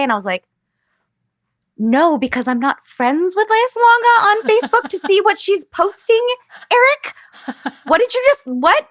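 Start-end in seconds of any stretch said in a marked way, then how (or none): 1.8-8.9 high, loud incredulous squeal; incredulous disbelief. Retold or imitated. To castmate Eric on hearing Leia posted about her, incredulous high squeal. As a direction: shriek it like you can't believe what you're hearing.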